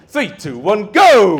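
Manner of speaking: high, loud GO; rousing countdown shout. Pretend. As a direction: launch the crowd with a big shout. Counting audience down to cheer.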